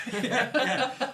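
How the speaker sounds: yeah yeah said through laughter; laughing. Real, not acted. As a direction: laugh while agreeing. Laughing at a height joke.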